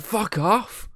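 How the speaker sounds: hammed-up, high exasperation; exasperated outburst. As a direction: retell it as squeaky exasperation at a device. Retold snap at his device.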